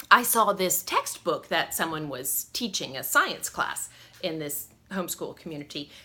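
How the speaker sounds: ordinary delivery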